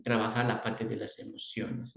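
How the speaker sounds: ordinary talk, nothing marked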